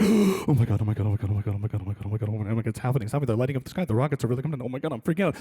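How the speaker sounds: low, breathless mock panic; imitated freak-out. Comedian seeing first rockets online, mock panicking. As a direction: run the phrases together in hushed alarm. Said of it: "I was freaking out".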